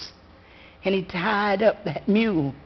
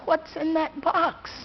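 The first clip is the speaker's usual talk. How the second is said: put-on wondering child; 7 semitones higher, 4 dB quieter than usual.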